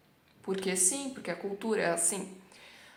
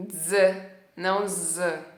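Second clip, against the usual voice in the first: ordinary talk, nothing marked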